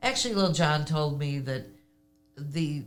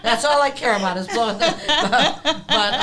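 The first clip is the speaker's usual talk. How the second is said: laughing through the words, louder and higher; 8 semitones higher, 8 dB louder than usual. Real, not acted. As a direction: giggle it out, delighted. Laughing about blowing his socks.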